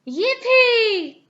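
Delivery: high, loud gleeful cheer; a little girl whooping with joy. A narrator's reading. Peppa cheers at the pancake news, high and loud. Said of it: "cheered Peppa".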